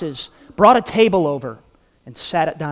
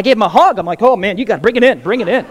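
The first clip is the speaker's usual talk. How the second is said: gushing; 4 semitones higher, 7 dB louder, much faster than usual. Retold or imitated. Hugging the chef excitedly.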